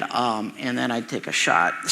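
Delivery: usual speaking voice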